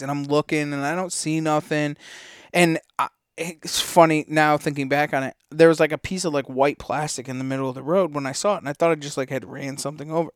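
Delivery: normal talking voice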